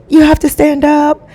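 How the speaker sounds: high, pressing mock urgency; urgent wife. Wife urging her drugged husband to stand, high mock urgency. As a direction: hurry him up in a high wife voice.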